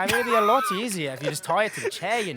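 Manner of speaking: steady British accent held on request. Shown as a demonstration. Voice actor shows the interviewer his British accent. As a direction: keep the British accent going, easy and casual. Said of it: "So now I can switch back to the New York one".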